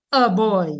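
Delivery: put-on "a boy" with a weak uh for "a"; Roseau pronunciation. Shown as a demonstration. Linguist shows town's way, Roseau pronunciation. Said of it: "In Roseau, we say".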